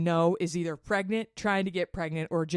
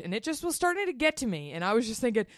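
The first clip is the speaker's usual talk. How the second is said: ordinary talk, nothing marked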